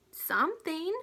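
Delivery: an eager, impatient nudge; impatient urging. Acted. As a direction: press him impatiently. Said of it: "urged Omri impatiently".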